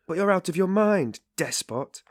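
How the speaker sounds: loud, high roaring rebuke. A narrator's reading. Razumikin to the raving Raskolnikov, roaring. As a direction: bellow at him in outrage. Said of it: "roared Razumikin".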